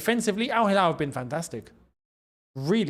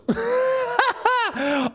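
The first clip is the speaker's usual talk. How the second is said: a sudden, high laugh; high burst of laughter. Real, not acted. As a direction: laugh out at the fixtures. Laughing at the Arsenal fixtures.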